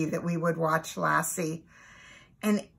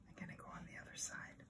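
whispered aside; 28 dB quieter, much faster than usual. Real, not acted. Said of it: "aside while turning page".

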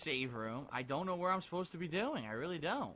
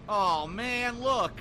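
excited; 6 semitones higher, 7 dB louder, much slower than usual. Real, not acted. Excited at spotting magnum.

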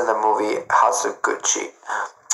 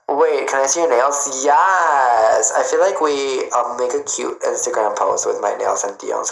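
ordinary talk

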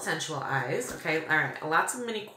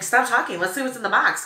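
higher, louder imagined viewer; impatient viewer; 5 semitones higher, 5 dB louder, faster than usual. Retold or imitated. Vlogger voicing viewers who want the box opened, impatient. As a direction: cut in like an impatient audience.

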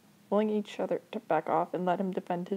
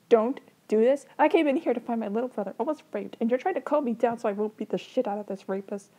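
loud, higher, indignant big brother; angry confrontation; 5 semitones higher, 5 dB louder than usual. Acted. Older brother confronting his little brother's attacker, angry. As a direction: burst out in outraged protest.

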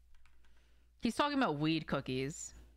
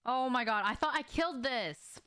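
surprised outburst; 6 semitones higher than usual. Real, not acted. Gamer spotting a target still alive, surprised outburst. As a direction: blurt it out like the enemy should be dead.

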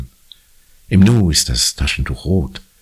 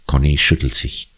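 usual speaking voice

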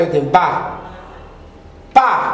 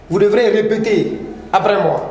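ordinary talk, nothing marked

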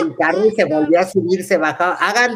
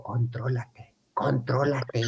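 soft, low "contrólate" twice; calm her down under your breath; hushed calming; 7 semitones lower, 10 dB quieter, slower than usual. Retold or imitated. Planner hushing the panicked bride, soft and low.

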